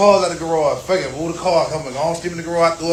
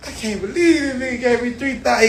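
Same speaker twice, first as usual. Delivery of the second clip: mocking whine; 7 semitones higher than usual. Mocking the crying friend.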